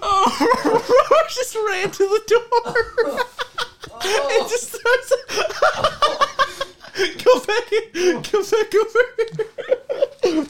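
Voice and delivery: words squeezed out through laughter; laughing hard. Real, not acted. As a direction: talk while cracking up, high. Laughing at partner fleeing.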